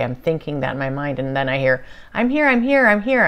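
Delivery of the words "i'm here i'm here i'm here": high, loud eager announcement; enthusiastic. Retold or imitated. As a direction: burst in eagerly, voice lifted. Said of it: "it's all enthusiastic".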